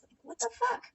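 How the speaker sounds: high squeak of disbelief on fuck; real incredulous outburst; incredulous high rising what the fuck. Real, not acted. Reacting in disbelief.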